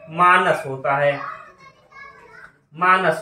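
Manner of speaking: मानस with a long first vowel, "maa-nas"; correct pronunciation. Shown as a demonstration. Teacher giving students the right word, long "maanas". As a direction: stretch the "aa" in मानस. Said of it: "क्या होता है?".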